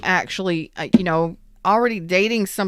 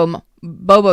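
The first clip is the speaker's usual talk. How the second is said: ordinary delivery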